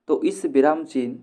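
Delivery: ordinary delivery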